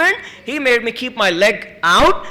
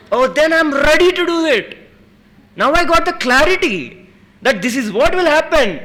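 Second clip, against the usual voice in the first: higher and louder, a rush of realisation; higher, louder burst of understanding; excited clarity; 9 semitones higher, 5 dB louder than usual. Retold or imitated. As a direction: suddenly get it and light up. Sudden excited realisation before the jump.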